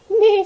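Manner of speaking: high, loud neigh; whinny like a horse. A narrator's reading. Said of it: "said the horse".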